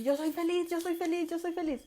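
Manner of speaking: high, loud chant of "yo soy feliz"; hammed-up motivational chant; mock shouted affirmation. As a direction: yell it like a pumped-up seminar crowd. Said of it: "es de gritar y decir".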